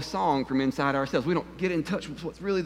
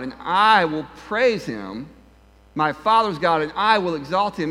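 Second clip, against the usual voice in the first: "I" punched hard each time, slow and loud; stress on I; 4 dB louder, slower than usual. Shown as a demonstration. Preacher reading the song aloud, stressing "I" and "my". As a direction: lean on every "I" and "my", loud and slow. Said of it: "notice where they place the emphasis".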